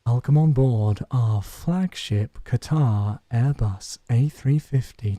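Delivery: very low, slow announcer voice; deep, measured welcome aboard, every word spaced out; airline boarding announcement. Pretend. Streamer welcoming passengers to the flagship Airbus, slow deep announcer voice.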